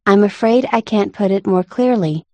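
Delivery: plain reading voice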